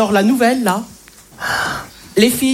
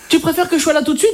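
higher, sweet, giggly submission; meek boyfriend; 6 semitones higher than usual. Acted. A man on the phone to his girlfriend, giggling and meek. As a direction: coo like a tamed boyfriend. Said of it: "Je vais vous montrer".

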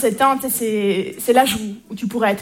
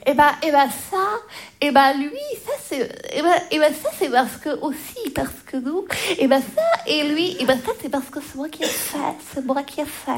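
put-on proud little boy; 3 semitones higher than usual. Four-year-old nephew proudly presenting his noodle necklace, stumbling childlike pride. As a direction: imitate a proud kid tripping over the explanation. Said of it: "Il m'a regardé hyper fièrement et il m'a dit".

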